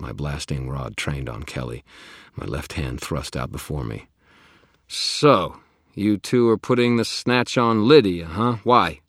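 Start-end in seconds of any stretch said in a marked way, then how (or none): 4.9-9.0 loud, high demanding challenge; challenging. Acted. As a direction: confront them hard. Wizard challenges vampires.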